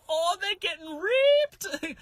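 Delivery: drawn-out high mock squeal; high-pitched squeal. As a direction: squeal it slowly from under the bed. Hidden boy reacting to the scolding.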